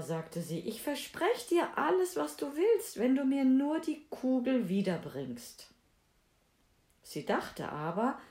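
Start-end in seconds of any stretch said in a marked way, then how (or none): 0.6-5.7 high, eager princess voice; eager princess promising. A narrator's reading. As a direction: promise eagerly, pitched well up.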